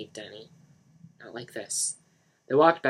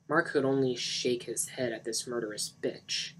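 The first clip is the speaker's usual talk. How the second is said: ordinary delivery, nothing marked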